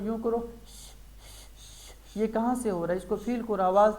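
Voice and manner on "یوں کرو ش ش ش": an unvoiced sh held out, tongue at the palate; hissed sh sound. Shown as a demonstration. Teacher showing a child where the sound comes from, long sh. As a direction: feel the air in ش, a long quiet hiss. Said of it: "بیٹا محسوس کرو".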